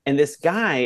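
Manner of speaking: ordinary talk, nothing marked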